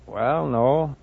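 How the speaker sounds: usual speaking voice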